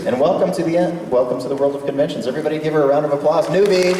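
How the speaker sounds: high, loud, fast crowd-rousing call; mock announcer welcome. Welcoming a convention newbie before the crowd, playful loud announcing. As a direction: hail the newcomer to the whole room.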